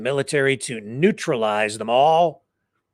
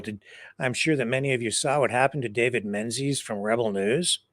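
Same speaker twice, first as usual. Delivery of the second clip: ordinary talk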